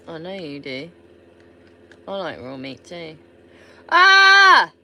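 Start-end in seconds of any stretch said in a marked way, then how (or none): 3.9-4.7 sharp high yelp of surprise; startled yelp. Real, not acted. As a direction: yelp out suddenly. Sudden startled outburst.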